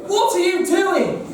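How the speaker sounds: sharp, high indignant question. Acted. Franz scolds Blanchard for dropping the horse, indignant. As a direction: snap at the sidekick in disbelief.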